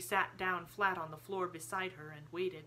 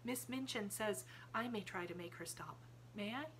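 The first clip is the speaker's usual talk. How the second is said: soft half-whisper; Sarah asks Miss Amelia in a hush; 4 semitones higher, 7 dB quieter than usual. A narrator's reading. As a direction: keep it hushed and calm. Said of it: "still in her half-whisper".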